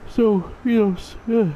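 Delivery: words swallowed by a big yawn. Real, not acted. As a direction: talk right through a yawn. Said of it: "sorry, I'm yawning again".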